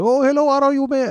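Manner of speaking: put-on high, loud Indian-accented greeting. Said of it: "I don't know why I'm doing the voice".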